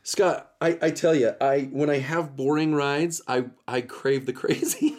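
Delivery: ordinary talk, nothing marked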